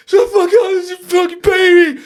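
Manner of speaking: high, full-voiced panicked yelling; frantic scream. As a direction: scream it wildly while fleeing. Running out in panic, screaming back.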